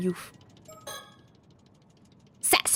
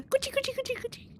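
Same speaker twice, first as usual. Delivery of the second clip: high, playful tickle chant; tickling sound; 9 dB quieter, much faster than usual. Shown as a demonstration. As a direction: coo it like tickling a baby.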